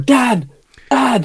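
shouted name across a pitch; high yelled "Dan!"; calling out. Retold or imitated. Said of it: "someone was going".

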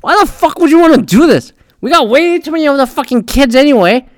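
exasperated outburst. Retold or imitated. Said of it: "but I'm like".

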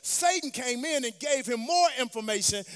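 loud, high-pitched sermon climax; full-force preaching. Real, not acted.

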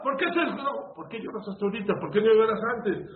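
high, loud preaching of God's reproach; God rebuking Noah from on high. Acted. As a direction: let the heavens scold Noah. Preacher voices Hashem rebuking Noah.

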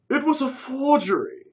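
high put-on British remark; breezy fake British. A narrator's reading. Curtis explaining the painting to Dave, fake British accent. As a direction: toss it off casually in the toff accent. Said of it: "he said simply".

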